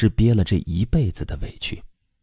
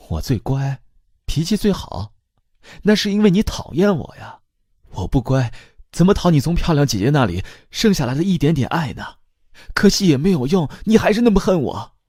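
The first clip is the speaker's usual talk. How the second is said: much higher, rising anguish; tearful outburst; 8 semitones higher than usual. A narrator's reading. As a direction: let the hurt spill over. Daughter's tearful outburst at mother. (激动哭诉)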